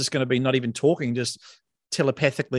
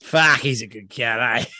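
1 Kiwi endearment; 4 semitones lower than usual. Retold or imitated. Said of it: "You know, like".

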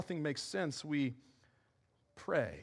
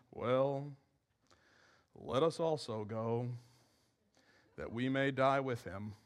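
gloomy Thomas; 7 semitones lower, 5 dB quieter, slower than usual. Retold or imitated. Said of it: "That's how he said it".

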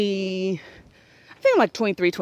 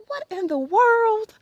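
baffled; 10 semitones higher, slower than usual. Retold or imitated. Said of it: "he's looking at me like".

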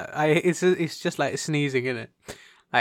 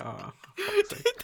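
exasperated. Real, not acted. Exasperated at yawning.

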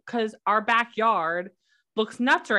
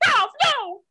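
mock yell; 12 semitones higher, 5 dB louder than usual. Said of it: "She was like".

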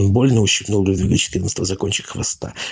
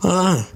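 sharp high cry of pain; yelp; 11 semitones higher than usual. Acted. Ludwig cries out as his tail is grabbed again. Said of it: "вскрикнул Людвиг".

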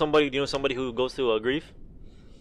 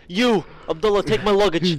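high, louder mock dad orders; imitating dad barking orders; dad ordering; 6 semitones higher, 4 dB louder than usual. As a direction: boss them like the hyped dad. Dad voiced in retold story.

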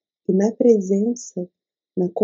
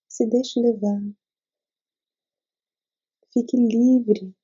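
guided meditation. Acted. Leads a meditation.

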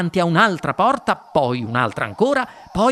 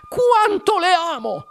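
very high, drawn-out gush; Wonka adorante. A narrator's reading. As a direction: swoon it out slowly up high. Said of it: "esclamò il signor Wonka". (dichiarazione d'amore acutissima e trascinata)

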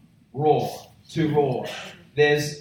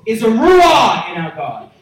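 higher and louder preaching building up; rising sermon; 5 semitones higher, 6 dB louder than usual. Acted. Pastor building up to the congregation about God's roar, rising preaching. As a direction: climb to full force for the congregation.

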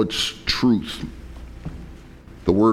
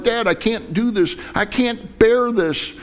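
high, pleading son's voice; in distress; 9 semitones higher than usual. Acted. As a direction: beg Dad as a son in distress. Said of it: "all of a sudden you're in distress".

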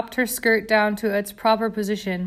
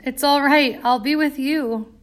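sobbing; 5 semitones higher, 3 dB louder than usual. A narrator's reading. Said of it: "Bella sobbed".

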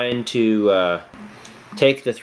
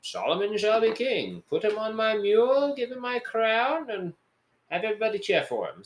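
higher-pitched royal proclamation; kingly decree; 9 semitones higher than usual. Retold or imitated. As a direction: proclaim it like a king. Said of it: "David is like".

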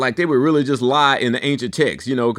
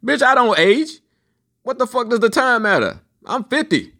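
high-pitched mock-indignant retort; indignant comeback; 9 semitones higher than usual. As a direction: throw it back at her, pitched way up. Retells his indignant comeback.

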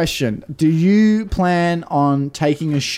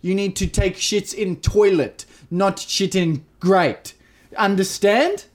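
over-slow, spelled-out broken English; slow, talking down; much slower than usual. Acted. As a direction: spell it out like to a child, very slowly. Talking down to townsfolk, slowly.

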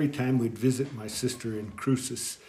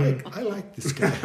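laughing quote; 6 semitones higher, 6 dB louder than usual. Retold or imitated. Retelling his reaction to priest.

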